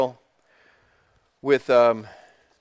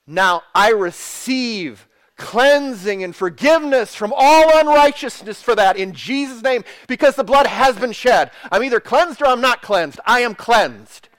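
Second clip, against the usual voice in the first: bold, higher declaration of faith; emphatic preaching; 4 semitones higher, 4 dB louder than usual. Acted. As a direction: declare it forcefully, drawing out the key words.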